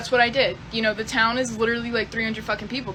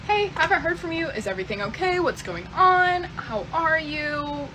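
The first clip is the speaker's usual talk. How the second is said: lightly raised, sing-song check-in; mock sweet text voice; 6 semitones higher than usual. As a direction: act overly sweet and casual asking how he is.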